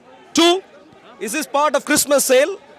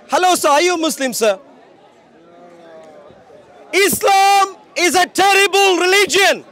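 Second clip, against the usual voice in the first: higher, louder, slow proclamation; street preaching at full force; 6 semitones higher, 3 dB louder than usual. Acted. Preacher proclaiming to a street crowd, loud and slow. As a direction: proclaim it from the rooftops.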